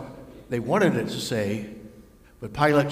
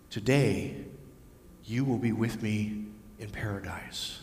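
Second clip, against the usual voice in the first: softer, gentle promise; tender promise; 5 semitones lower, 6 dB quieter than usual. Acted. Jesus promises the thief paradise, soft and gentle. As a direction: speak it softly, like a gift. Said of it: "those wonderful words".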